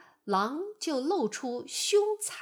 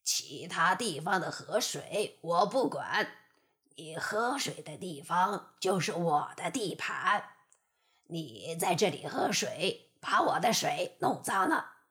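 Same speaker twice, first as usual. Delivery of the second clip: low, sinister wolf voice; a sly wolf claiming the river; 5 semitones lower than usual. Acted. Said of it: "狼阴险地笑了笑". (阴险的狼声)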